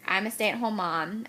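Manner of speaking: ordinary delivery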